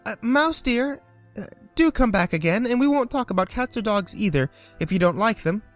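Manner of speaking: soft call. A narrator's reading. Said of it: "she called after it softly".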